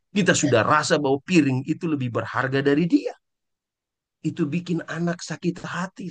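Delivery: usual speaking voice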